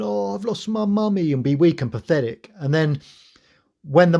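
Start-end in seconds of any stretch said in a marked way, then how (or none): 0.0-1.3 put-on high, whiny little-boy wail; mock crying. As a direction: whimper it mockingly like a boy lost without his mother. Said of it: "would be crying".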